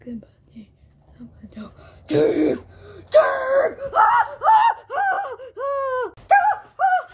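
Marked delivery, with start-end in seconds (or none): 3.1-7.1 high, loud panicked screams; startled screaming. Acted. Scared boy screams at the intruder, high and loud. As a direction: shriek in fright at the figure in the dark.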